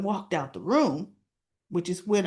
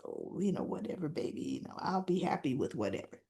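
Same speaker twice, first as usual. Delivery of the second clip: low, hushed, nearly whispered; soft reassurance; 6 semitones lower, 12 dB quieter, faster than usual. Retold or imitated. Bill answering the toast about a baby, acted soft reassurance. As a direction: murmur it easily, imitating an agreeable partner.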